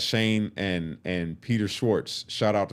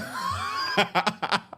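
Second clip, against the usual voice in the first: high burst of laughter; real laugh; 11 semitones higher than usual. Real, not acted. Storyteller amused by inmate's excuse, real high laughter. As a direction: crack up at the excuse.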